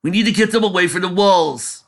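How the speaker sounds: loud, high battle command; rally the troops, loud; commanding shout. Acted. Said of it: "commanded the dragon".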